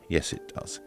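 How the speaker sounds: normal talking voice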